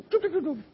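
quick, high-pitched "doop" sounds; playful high sound effect; imitated popping noise. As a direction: mimic corn popping open in little high bursts. Imitates corn ears popping open.

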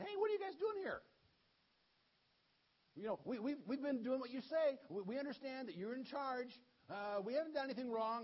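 mock-worried townsmen defending themselves; nervous pleading. Nervous men of Judah plead.

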